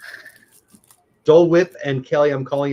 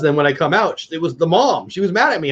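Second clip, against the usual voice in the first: normal talking voice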